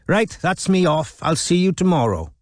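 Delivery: put-on high, lilting Welsh accent; sing-song Welsh, pitched well up; Geraint. A narrator's reading. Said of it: "Geraint's lilting accent filled the office".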